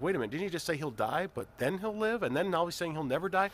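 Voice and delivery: high, incredulous double-take; mock confusion, rising in pitch; puzzled surprise. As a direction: sound baffled by the verse. Preacher voices puzzled listener.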